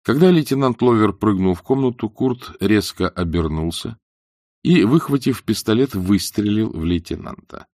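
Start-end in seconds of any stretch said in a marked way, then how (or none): none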